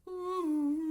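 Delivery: a loud, high ooh breaking into laughter; giddy shriek of laughter; high squeal and laugh. Real, not acted. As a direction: squeal with delight, then laugh it out.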